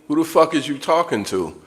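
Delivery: ordinary delivery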